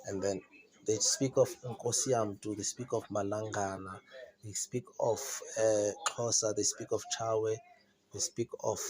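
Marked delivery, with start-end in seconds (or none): none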